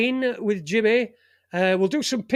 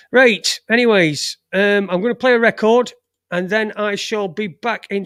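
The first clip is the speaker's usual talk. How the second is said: louder, brisk radio call; loud announcement; 7 dB louder than usual. Real, not acted. Host announcing the next record, loud. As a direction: snap back to the mic.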